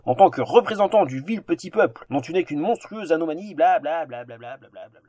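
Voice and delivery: insufferable royal lamb. Acted. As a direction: declaim like a haughty little lamb. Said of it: "d'une voix rendue insupportable par sa nature ovine".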